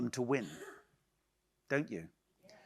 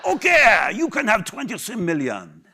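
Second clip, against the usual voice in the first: investor's voice; 5 semitones higher, 7 dB louder than usual. Retold or imitated. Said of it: "Mister French Algerian eating his own babies".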